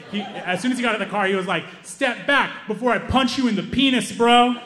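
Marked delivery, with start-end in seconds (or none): 1.8-4.5 higher, nervous mock-tough threat; a nerdy brother trying to sound tough; awkward threat. Nerdy little brother threatening the angry guy, mock-tough. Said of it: "hadn't workshopped any of his insults".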